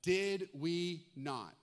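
very slow Did we not; drawn-out question. Shown as a demonstration. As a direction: stretch each word out. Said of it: "underline this".

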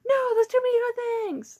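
mock teacher quote. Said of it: "it's like".

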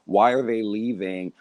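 normal talking voice

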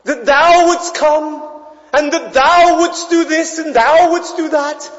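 mock grand church prayer voice; posher prayer voice. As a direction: intone it like an old-fashioned pompous prayer. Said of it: "this posher voice".

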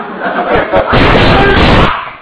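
a high rising wail with no words; wailing sob. Acted. Father howling in grief over his daughter. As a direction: let out a long keening howl. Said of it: "放声痛哭".

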